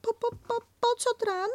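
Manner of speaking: tiny alarmed stammer; Piglet stammering. Acted.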